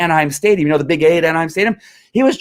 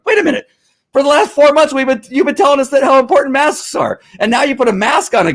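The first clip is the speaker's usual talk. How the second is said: real heated yelling; 10 semitones higher than usual. Real, not acted. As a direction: yell it at the media like a fed-up ex-cop. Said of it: "started yelling and screaming".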